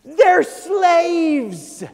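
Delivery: loud, punched declaration; preacher shouting that they were slaves; emphatic shout. Real, not acted. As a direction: drive the point home hard. Preacher stressing the point.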